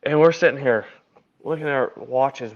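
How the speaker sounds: ordinary delivery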